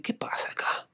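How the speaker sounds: hushed, breathless disbelief under the breath; frustrated whisper. Retold or imitated. Actor whispering at his broken phone in disbelief. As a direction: whisper it like you can't believe it. Audition recording fails again.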